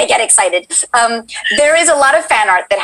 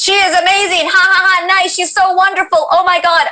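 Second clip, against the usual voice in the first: voicing thrilled fans at a high pitch; excited chat; 8 semitones higher than usual. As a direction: read out gushing comments, high-pitched. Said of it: "the chat is, is very excited".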